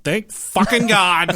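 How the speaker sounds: hammed-up thankfulness at escape; retold relief. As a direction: draw it out with relief. Retold relief at being called.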